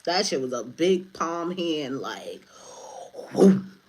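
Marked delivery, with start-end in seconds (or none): 3.3-3.8 loud, sudden "whoom" sound effect; imitated whoosh. Showing a mighty hand swiping, imitated whoosh. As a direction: make the sound of a mighty swipe.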